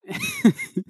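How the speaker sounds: high burst of laughter; real laugh. Real, not acted. Telling the cartoon's ending, bursting into high laughter. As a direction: crack up at the joke.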